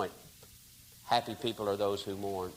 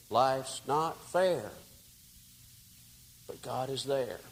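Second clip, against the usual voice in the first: tender comfort; 2 semitones higher, slower than usual. Retold or imitated. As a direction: comfort a broken man. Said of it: "all that melted away".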